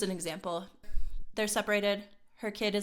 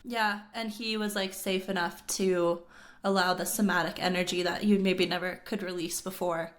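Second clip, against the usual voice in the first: normal talking voice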